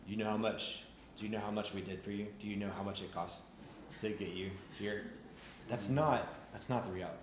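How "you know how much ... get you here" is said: a hint of a disappointed God, lower and much softer; guilt-tripping low murmur, not a real shout; mock scolding God. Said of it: "God's gonna be standing there like".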